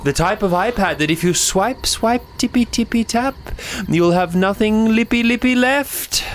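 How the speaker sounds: high singsong spooky rhyme. Acted. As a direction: chant it like a creepy nursery rhyme. Ghostly iPad rhyme.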